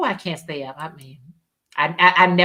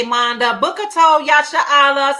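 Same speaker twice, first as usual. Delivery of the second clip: normal talking voice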